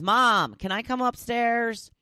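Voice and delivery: high, loud pleading little voice; pleading pet. Pretend. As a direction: beg to come upstairs. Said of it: "Roxanne says".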